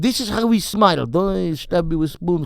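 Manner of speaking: put-on thick comic Russian accent. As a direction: do a hammed-up Russian accent, slowing down. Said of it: "I could do the Russian voice".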